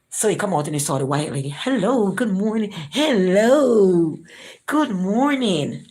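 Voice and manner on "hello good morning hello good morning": higher, sing-song put-on politeness; fake friendly greeting. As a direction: greet her with an exaggerated fake sweetness.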